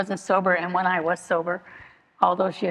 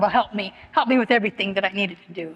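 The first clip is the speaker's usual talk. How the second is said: ordinary talk, nothing marked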